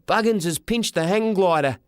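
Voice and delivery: put-on high, indignant boy; boy's voice. A narrator's reading. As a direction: report it high like an aggrieved son. Boy reports the theft.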